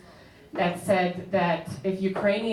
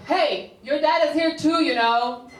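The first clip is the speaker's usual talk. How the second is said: higher, mildly raised heckle; mock drunken shout; 7 semitones higher, 3 dB louder than usual. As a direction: imitate a drunk guy calling out from the audience. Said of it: "some drunk guy shouted out".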